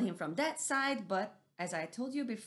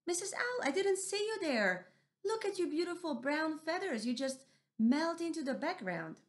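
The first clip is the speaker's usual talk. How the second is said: high, bright-eyed little animal voice; surprised mouse deer; 9 semitones higher than usual. Acted. Mouse deer apologizing and admiring Missus Owl. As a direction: gush over her feathers, caught off guard.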